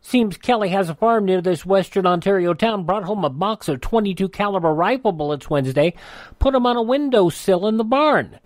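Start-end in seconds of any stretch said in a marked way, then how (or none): none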